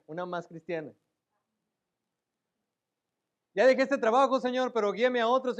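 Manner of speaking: parodia exaltada, más aguda y fuerte; creyente terco parodiado. Pretend. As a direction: burla del que decide solo y luego pide guía. Mocking self-willed believer talking to God. (parodia exaltada y más fuerte)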